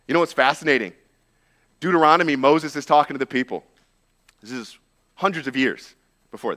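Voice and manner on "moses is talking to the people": ordinary talk, nothing marked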